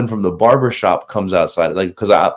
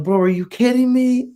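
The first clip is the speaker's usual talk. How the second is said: very high, incredulous squeal; retold disbelief. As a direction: act out stunned disbelief way up high. Retold reaction to being told no.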